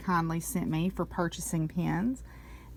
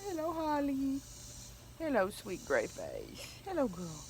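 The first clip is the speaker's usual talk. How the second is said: tender dog greeting; 7 semitones higher, 9 dB quieter than usual. Real, not acted. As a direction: coo gently to a gray-faced pet. Greeting her old dog.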